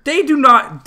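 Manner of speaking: loud, high burst of protest; indignant cry; outraged shout. Real, not acted. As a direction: shout it in disbelief. Ranting about fake accents.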